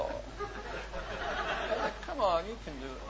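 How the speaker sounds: words squeezed out through laughter; can't stop laughing while saying it. Real, not acted. Laughing while retelling coaxing.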